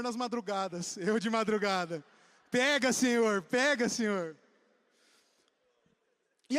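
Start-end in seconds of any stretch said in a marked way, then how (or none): 2.5-4.4 fervent prayer cry. Acted. Praying at dawn in meme.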